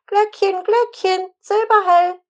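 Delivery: put-on a small girl calling out the charm in a high voice; bright high call. A narrator's reading. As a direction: ring it out high like a child's bell. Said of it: "rief Sanni mit heller Stimme".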